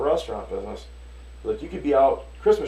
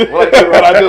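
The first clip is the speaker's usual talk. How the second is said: high and loud through real laughter; laughing; 8 semitones higher, 17 dB louder than usual. Real, not acted. Telling friends about his wife's accent, laughing hard. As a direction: laugh the words out helplessly. Laughing while retelling wife scene.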